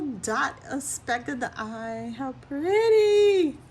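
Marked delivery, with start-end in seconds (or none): 2.2-3.6 high, loud, drawn-out gush; delighted coo. Real, not acted. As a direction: swoon at how pretty. Gushing over bunnies.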